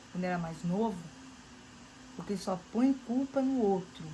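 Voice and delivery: pregação exaltada. Acted. Pregação exaltada, depois oração.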